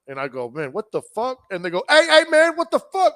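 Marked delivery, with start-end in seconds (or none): 0.5-1.4 restrained, puzzled question; mild retold bewilderment. Driver retelling his reaction to the windows rolling down, mildly bewildered. 1.9-3.1 high, loud challenge from strangers; alarmed mock yell. Driver voicing men in the SUV at a stranger, alarmed yelling.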